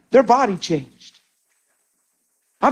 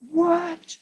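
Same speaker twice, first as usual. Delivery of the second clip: tearful what; 8 semitones higher, 7 dB quieter than usual. Retold or imitated. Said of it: "in their car crying and going".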